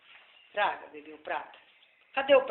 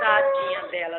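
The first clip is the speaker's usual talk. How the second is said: very high, squeaky mock pity; 5 dB louder than usual. Pretend pity for the doll. (dó fingido com voz fininha)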